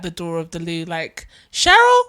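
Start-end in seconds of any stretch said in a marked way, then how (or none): 1.5-2.0 loud, high imitation of a husband calling his wife; mock impatient yell. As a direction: holler the name through a door. Voicing husband calling at loo door.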